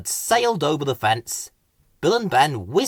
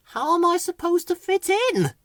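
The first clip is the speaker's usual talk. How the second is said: much higher, dismayed bird voice. A narrator's reading. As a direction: plead it, at a loss. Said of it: "in dismay".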